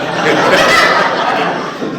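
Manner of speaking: a burst of breathy laughter; real laugh breaking out. Real, not acted. Preacher laughing at his own joke, loud breathy laugh.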